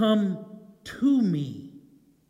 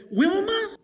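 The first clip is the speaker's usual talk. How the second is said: "Wilma?" much higher, questioning; reporter's high sudden question; mock call-out; 9 semitones higher than usual. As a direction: pop the name up high like a reporter. News camera calls the woman.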